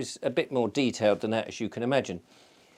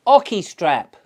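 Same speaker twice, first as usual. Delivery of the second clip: put-on higher and louder Australian accent, insistent; Barbara's Australian accent; 7 semitones higher, 9 dB louder, slower than usual. Barbara O'Neill repeating her question, louder Australian accent. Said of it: "'Cause she's Australian".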